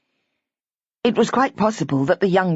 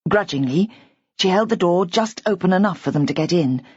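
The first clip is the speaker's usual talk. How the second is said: usual speaking voice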